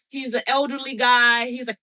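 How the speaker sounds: usual speaking voice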